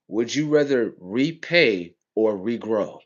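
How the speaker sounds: slow, pointed rhetorical question; slow and deliberate. Shown as a demonstration. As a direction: draw out repay and regrow slowly. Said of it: "Let me show you how stupid they got you".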